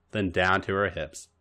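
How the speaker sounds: ordinary delivery, nothing marked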